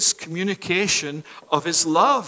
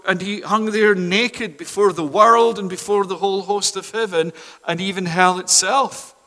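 ordinary talk

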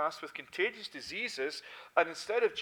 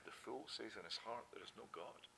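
softly dropped scripture line, much softer; quiet quote; 6 semitones lower, 15 dB quieter than usual. Shown as a demonstration. Preacher quoting the fool's denial, much softer. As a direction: hush it down to almost nothing. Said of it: "of whom it is that it said".

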